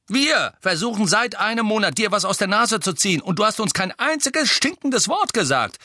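high, loud, outraged outburst; calling out. A narrator's reading. Twin complaining of a month without answers, crying out. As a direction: cry out the complaint. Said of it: "rief George".

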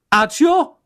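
high, loud spell call; spell cast. A narrator's reading. Witch summoning with a high, loud Accio. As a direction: fling the incantation out.

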